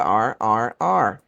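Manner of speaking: "are" repeated with the R stretched; pulled R. Shown as a demonstration. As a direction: drag the R of "are" each time. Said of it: "vocês já viram como é".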